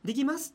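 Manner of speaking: "できます" pitched high, end lifted; rising sentence ending. Shown as a demonstration. Host showing event MC habit, "できます" rising at the end. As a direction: send the "ます" of "できます" up. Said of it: "語尾が上がる".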